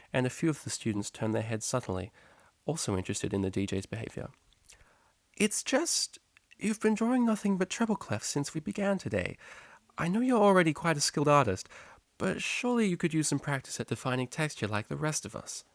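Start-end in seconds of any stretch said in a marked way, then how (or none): 5.3-15.6 higher, coaxing teacher voice; a teacher gently nudging a student; patient high teacher; teacher's voice. A narrator's reading.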